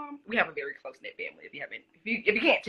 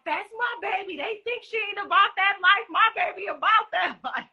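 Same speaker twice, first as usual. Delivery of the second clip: high, proud mom voice with a laugh; mama bragging about her baby; high-pitched proud mother impression; imitating mom; 13 semitones higher than usual. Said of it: "I was like".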